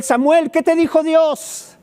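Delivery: high, pressing Eli asking Samuel. Acted. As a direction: voice the old priest begging for the word. Eli voiced pleading to Samuel.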